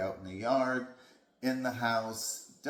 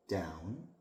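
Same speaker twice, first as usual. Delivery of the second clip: soft, even command; nice calm voice; 3 semitones lower, 6 dB quieter than usual. Shown as a demonstration. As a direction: say it gently and calmly, no edge. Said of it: "in a nice, calm voice".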